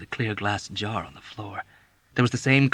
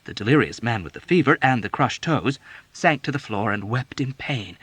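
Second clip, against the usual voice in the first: ordinary delivery, nothing marked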